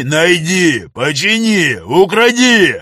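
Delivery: building to a high roar; furious yelling. Acted. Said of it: "заорал негр на рабыню".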